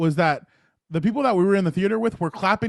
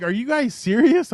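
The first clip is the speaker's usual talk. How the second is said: higher, slow, incredulous question; retold incredulity; 7 semitones higher, 4 dB louder than usual. Journalist to fans who waited days, retold incredulity. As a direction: question them in stretched-out disbelief. Astonished at fans' long wait.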